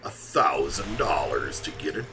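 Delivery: swooping, dramatic trailer voice; theatrical suspense voice-over; mock movie trailer narrator. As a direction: narrate it like a hammed-up film trailer.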